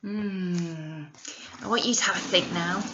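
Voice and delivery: ordinary delivery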